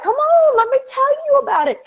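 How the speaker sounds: high, fast, excited sales pitch; eager quote. Retold or imitated. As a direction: gush about it like a convert. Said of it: "they're like".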